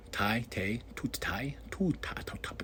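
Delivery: soft unvoiced mumbling of the name; playful whisper. Real, not acted. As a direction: whisper it to yourself, toying. Playful muttering over the name.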